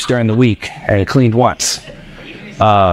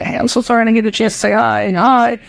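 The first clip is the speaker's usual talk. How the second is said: high, hurried friendly apology; acted catch-up hello, quick and high; apologetic greeting; 9 semitones higher than usual. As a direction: rush out a high-pitched, eager sorry. Pastor acts out apologetic greeting.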